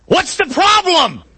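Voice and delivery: high, slow shout over the wind; yelling back. Acted. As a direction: bellow it back through a storm. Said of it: "he has to yell back".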